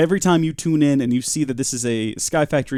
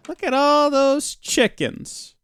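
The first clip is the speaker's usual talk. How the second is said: high, drawn-out excited squeal; mock awed exclamation; 13 semitones higher, slower than usual. Gamer quoting a line at the chickens, high mock wonder. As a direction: stretch it out high and slow, in put-on wonder.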